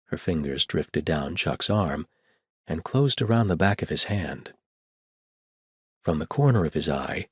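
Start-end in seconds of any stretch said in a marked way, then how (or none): none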